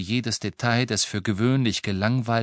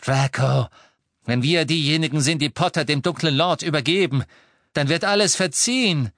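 high, loud, eager persuasion; excited urging; 6 semitones higher, 5 dB louder than usual. A narrator's reading. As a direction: urge your son on excitedly. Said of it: "noch nie so aufgeregt erlebt". (aufgeregt drängend)

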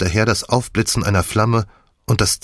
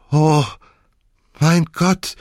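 gasping in shock; 8 semitones higher, slower than usual. A narrator's reading. Misses Croft exposed before everyone, gasping in horror. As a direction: gasp it out, caught out. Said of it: "keuchte Misses Croft".

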